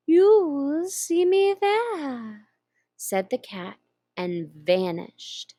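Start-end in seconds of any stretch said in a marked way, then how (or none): 0.1-2.5 slow, purring Cheshire Cat voice; slow drawling cat. Acted. Grinning cat teasing the lost girl, slow and drawn out. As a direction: stretch each word like a lazy cat.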